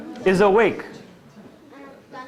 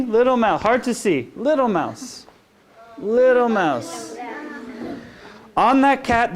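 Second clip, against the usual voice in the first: sing-song picture-book reading; gentle read-aloud for little kids; reading to children; 2 semitones higher than usual. Acted. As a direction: lull them along the bedtime rhyme.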